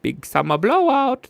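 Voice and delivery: high, loud sing-song "yoo-hoo" call; playful high-pitched meme quote. Retold or imitated. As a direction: squeal it out like a cheery cartoon seal. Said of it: "and we say".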